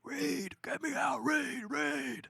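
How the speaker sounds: a hammed-up trapped cry for Reed; retold call for help. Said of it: "going like".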